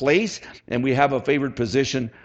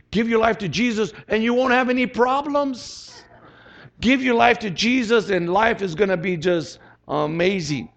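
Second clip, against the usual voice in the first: high, lilting too-good-to-be-true pitch; mock sales promise; 6 semitones higher than usual. Preacher imitating those who tell people wrong, mock-rosy. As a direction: imitate someone overselling an easy life.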